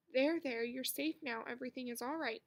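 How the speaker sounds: ordinary delivery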